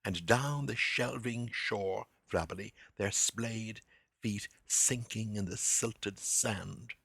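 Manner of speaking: slow, savouring the "s" in "shelving shore", "splayed", "sinking", "silted sand"; hissing the s sounds. Shown as a demonstration. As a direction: lean on every s, slowly. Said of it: "Listen to the recurring letter S".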